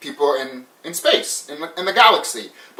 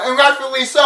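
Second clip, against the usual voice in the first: usual speaking voice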